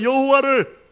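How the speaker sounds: loud, high call for the congregation to repeat; 따라 외치도록 선창. Shown as a demonstration. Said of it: "따라합시다". (따라 하라고 높고 크게 선창)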